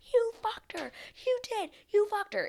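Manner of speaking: very high-pitched imitation of an accuser; exaggerated high, accusing voice; mock accusation. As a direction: squeal the accusation in a mocking high voice. Acting out woman's accusation from preview.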